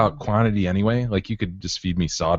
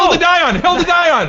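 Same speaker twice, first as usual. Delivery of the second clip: high shriek; 6 dB louder than usual. Acted. Mocking friend's odd stance.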